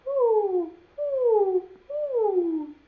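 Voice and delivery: high, loud owl hoots; owl hooting. Acted. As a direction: hoot like a little owl. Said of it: "An owl says".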